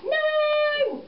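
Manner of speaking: a sudden high, loud "No!"; shout. Acted. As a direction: shout it out to stop him. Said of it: "she shouts".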